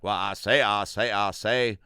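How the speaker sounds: blustery cartoon rooster catchphrase; Foghorn Leghorn. Recalling the big rooster's catchphrase, mock bluster. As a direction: repeat it like a pompous windbag rooster. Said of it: "Him and Foghorn Leghorn".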